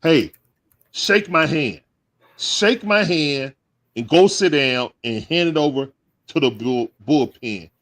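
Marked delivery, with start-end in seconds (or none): 0.0-3.5 slow, higher, drawn-out catchphrase. Pretend. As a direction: stretch the words out like a signature line. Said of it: "y'all know what I'm gonna say".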